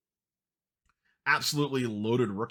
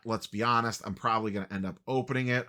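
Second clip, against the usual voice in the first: ordinary delivery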